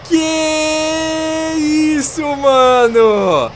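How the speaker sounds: high, loud, drawn-out disbelief; stunned and astonished. Real, not acted. After failed landing.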